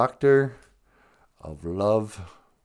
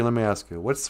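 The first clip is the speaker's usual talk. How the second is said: ordinary delivery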